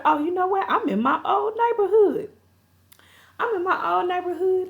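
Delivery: high, sing-out voice of recognition; imitating someone high-pitched with realization; acted sudden realization. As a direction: pitch it way up as it dawns on you. Voices Trouble's sudden realization in chase.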